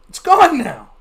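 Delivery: normal talking voice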